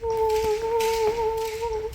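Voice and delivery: high ghostly wail; a long, high haunting ooh; spooky ooh. Pretend. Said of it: "can't help but screw around".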